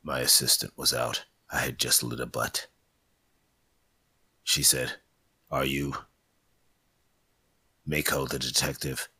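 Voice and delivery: low, hard-boiled narration; noir detective. Acted. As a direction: narrate like a film noir gumshoe.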